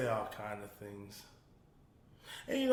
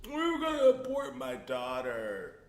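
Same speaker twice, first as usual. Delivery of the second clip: mock crying on stage; 10 semitones higher, slower than usual. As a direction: ham up a weepy confession, slowly and high. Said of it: "he was crying on stage saying".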